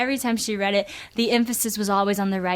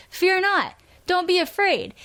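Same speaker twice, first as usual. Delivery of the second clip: high, stern commanding tone; hearing it as a command; 9 semitones higher than usual. Retold or imitated. Anxious girl hears mom's verse as a stern order. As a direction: bark it like an order. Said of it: "all I could hear was the words".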